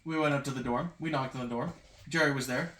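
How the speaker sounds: usual speaking voice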